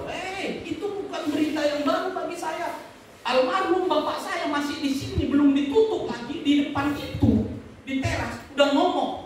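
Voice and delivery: high-pitched, insistent retort; heated rebuttal. Real, not acted. Preacher answering old gossip about his family, heatedly.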